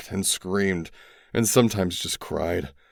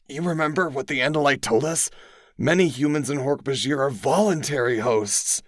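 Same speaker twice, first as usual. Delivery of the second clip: lightly voiced, higher young friend; a hint of a higher boy's voice; Tobias voice; 8 semitones higher than usual. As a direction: explain it grimly as Tobias.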